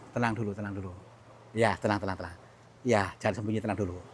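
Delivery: gently calming the weeping spirit; soothing. Real, not acted. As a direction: hush a frightened being.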